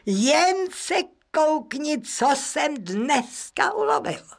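high, drawn-out proud announcement; triumphant mayor in a high, slow voice; boasting husband. Acted. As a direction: show off your catch to your wife. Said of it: "a povídá ženě".

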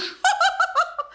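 sharp high scornful laugh; a derisive burst of laughter; mocking laugh. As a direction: laugh in his face at the very idea. Widow mocks the man's claim.

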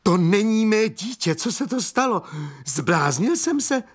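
high-pitched, panicked outburst; frantic cry. A narrator's reading. Young man sees it is not his child, frantic cry. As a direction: wail it high, in utter disbelief. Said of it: "křičel mladík s vytřeštěnýma očima".

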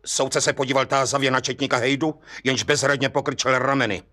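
ordinary delivery, nothing marked

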